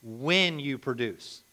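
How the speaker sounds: slow, deliberate "When" after a pause; stress on "When". Real, not acted. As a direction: set "When" apart as the correction. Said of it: "he said".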